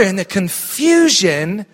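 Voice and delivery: ordinary delivery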